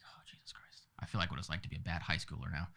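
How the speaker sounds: hushed, half-whispered self-talk; muttering to oneself. Retold or imitated. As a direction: mutter privately into the bathroom mirror. Muttering to mirror in bathroom.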